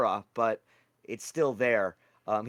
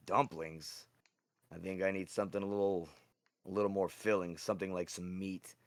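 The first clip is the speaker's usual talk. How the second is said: a hint of a low, soft character voice; lightly voiced Zoroff; 4 semitones lower, 8 dB quieter than usual. Zoroff tells August he needs something filling, lightly voiced low. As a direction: drop low and quiet, musing on food.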